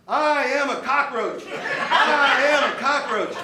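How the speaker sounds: high, slow chant broken by laughter; laughing mock yell. Recruits on the ground yelling as ordered, laughing high chant. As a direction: chant it while cracking up at yourself. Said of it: "start yelling".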